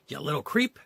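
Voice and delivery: a milder reread of the yell; shouted. Acted. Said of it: "shouted the thief".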